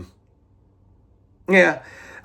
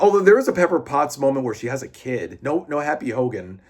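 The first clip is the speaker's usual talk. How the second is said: ordinary delivery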